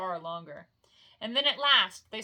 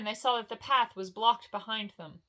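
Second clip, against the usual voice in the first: ordinary delivery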